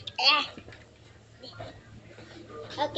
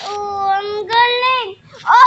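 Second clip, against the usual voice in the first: excited gushing; 3 semitones higher, 7 dB louder, slower than usual. Real, not acted. Gushing over the pet's trick.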